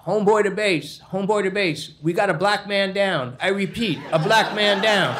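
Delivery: high-pitched radio-style call-out; mock yelled call. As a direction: yell it out like a teasing dispatch. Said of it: "he starts yelling out".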